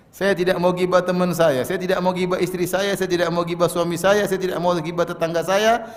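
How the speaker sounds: normal talking voice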